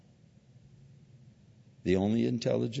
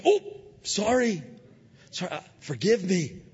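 lightly voiced, higher apology; mock apology; 9 semitones higher than usual. Slapper apologizing to the pastor, lightly mocked. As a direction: toss off a quick sorry.

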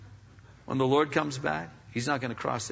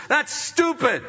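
loud, high scorn; scornful outburst; 13 semitones higher, 5 dB louder, slower than usual. Real, not acted. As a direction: spit it out with contempt.

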